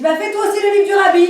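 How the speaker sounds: high, loud offhand suggestion; calling down. Retold or imitated. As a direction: shout it down casually. Wife calling down to husband.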